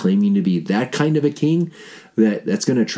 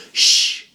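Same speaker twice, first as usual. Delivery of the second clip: shushing. Acted. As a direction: hush them like a secret. Jesus hushes the apostles.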